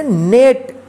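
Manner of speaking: नेट drawn out slowly, the ई read as ए; slow pronunciation demo. Shown as a demonstration. Teacher repeating नेट slowly for pupils. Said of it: "ई का ए उच्चारण होगा, जैसे".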